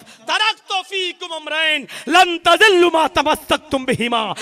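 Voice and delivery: loud, fervent sermon call; preaching at full force. Acted. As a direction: rouse the congregation. Preacher calls people to Quran and Sunnah. (जोशीली तक़रीर, ऊंची आवाज़ में)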